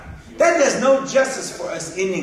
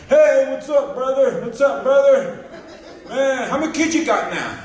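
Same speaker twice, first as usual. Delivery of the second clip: brash buddy greeting; 8 semitones higher than usual. Retold or imitated. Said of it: "he's like".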